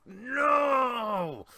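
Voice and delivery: a high, loud cry of grief; anguished shout. Acted. Weeping father crying out to the heavens, anguished shout. Grieving man cries to the sky.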